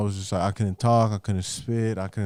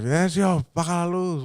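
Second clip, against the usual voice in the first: calling grandma; 8 semitones higher than usual. Retold or imitated.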